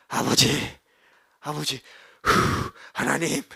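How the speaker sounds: slow, halting prayer with a heavy breath; a touch of choked-up pleading; overwhelmed prayer. Retold or imitated. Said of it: "이러는 거죠".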